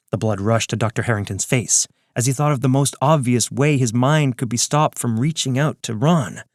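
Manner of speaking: usual speaking voice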